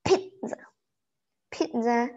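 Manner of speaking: usual speaking voice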